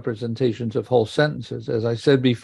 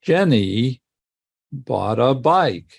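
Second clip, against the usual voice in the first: slow tune, Jenny rising, then a pause, bike falling; demonstrated intonation contour; much slower than usual. Shown as a demonstration. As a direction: lift the end of Jenny, pause, drop on bike. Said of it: "So it sounds like this".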